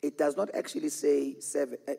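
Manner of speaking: normal talking voice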